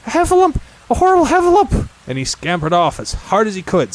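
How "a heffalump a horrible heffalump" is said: high, loud terrified squeal; panicked cry. Acted. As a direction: yell the monster's name in terror. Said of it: "still crying out".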